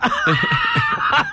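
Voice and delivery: high burst of laughter. Real, not acted. Laughing at Hasselhoff idea.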